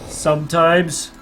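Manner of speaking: normal talking voice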